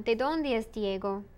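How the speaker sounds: "¿De dónde es Diego?" with the tone going down to Diego; falling question intonation. Shown as a demonstration. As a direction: end "¿De dónde es Diego?" low, as a question-word question. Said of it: "You notice the intonation goes down".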